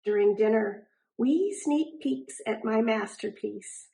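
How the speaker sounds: plain reading voice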